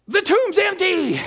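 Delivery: high excited exclamation; excited cry. Acted. Disciple at the empty tomb, excited exclamation.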